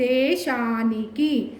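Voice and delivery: slow "దేశానికి" with long "దే" and "శా"; drawn-out long vowels. Shown as a demonstration. Teacher showing how we say it, vowels stretched slowly. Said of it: "ఎలా పలుకుతున్నాము?".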